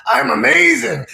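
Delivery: high, drawn-out exultant cry; really feeling it. Shown as a demonstration. Leading listeners to really feel it, exultant. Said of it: "Really feel it now".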